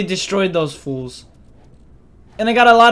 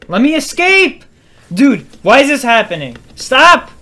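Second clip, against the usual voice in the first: high, loud desperate shouting; desperate yell; 5 semitones higher, 6 dB louder than usual. Real, not acted. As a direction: yell to get away. Trapped in combat.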